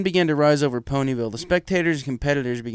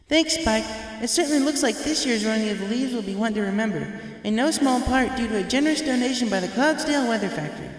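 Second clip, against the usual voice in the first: much higher, bright co-host voice; Twilight announcing; 11 semitones higher than usual. Acted. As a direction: take over the broadcast cheerfully. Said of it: "Twilight announced".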